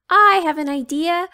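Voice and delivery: bursting with an idea; excited announcement; eager idea. A narrator's reading. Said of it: "said Miss Fix-It".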